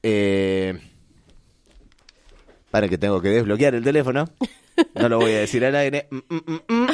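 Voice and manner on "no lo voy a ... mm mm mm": Susana. Retold or imitated. Host dodging saying it on air, playful hummed imitation. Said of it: "diría Susana".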